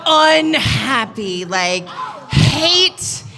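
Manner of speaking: slow, loud, drawn-out key words; emphatic outburst. Retold or imitated. MC demanding the most miserable couple, loud and drawn out. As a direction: break the hush with slow, heavy emphasis. MC bursts out after hush.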